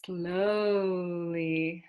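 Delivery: "Slowly" stretched long and slow. Shown as a demonstration. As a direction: draw the word out. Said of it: "say it in a slow way".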